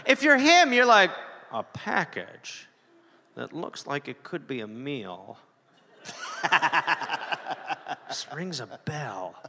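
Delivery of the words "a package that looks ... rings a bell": low, soft, slow muttering voice; low quiet character voice. Acted. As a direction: murmur it low and slow to yourself. S0 acts out the guy receiving it.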